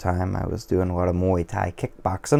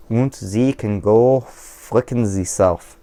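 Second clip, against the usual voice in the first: slow, hammed-up fake German accent; a lightly done fake German accent, mangling the insult on purpose; a mock German accent told off at anyone who insults him; 3 semitones higher, 4 dB louder, slower than usual. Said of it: "I didn't say that right".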